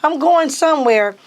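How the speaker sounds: high no, set and sure; teen refusing an all-girls college, high and firm; firm refusal. Retold or imitated. Teen insisting.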